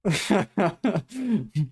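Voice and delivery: short high burst of laughter; amused laugh. Real, not acted. Streamer laughing at a pro's run, short high laugh. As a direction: laugh at what you're watching.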